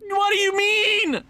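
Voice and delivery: high, slow cry of disbelief; stretched-out, high-pitched exasperation; incredulous protest. Real, not acted. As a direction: wail it in outraged disbelief. Offer rejected again.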